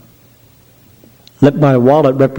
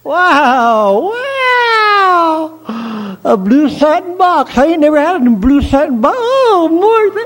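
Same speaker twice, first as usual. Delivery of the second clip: put-on much higher, gushing falsetto girl; sweet melodious voice; 14 semitones higher, much slower than usual. Mabel gushes in a high falsetto to her sweetheart Mort. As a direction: coo at him like a swooning girlfriend. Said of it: "And you say".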